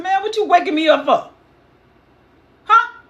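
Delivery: high, loud, indignant complaint; a dead prophet grumbling at being woken; annoyed Samuel. Acted.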